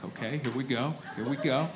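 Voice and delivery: quiet hushed here we go; steady yourself quietly before the vows; mock solemn psyching up. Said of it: "I'm very serious".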